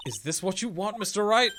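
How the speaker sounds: high plea rising to a loud finish; urgent challenge. Acted. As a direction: confront your client, pitch climbing. Lawyer pleading urgently with client.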